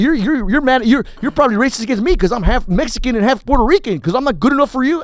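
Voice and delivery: high, loud mock-outraged rant; hammed-up indignation at the dog show; mad rant. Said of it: "I got mad at them".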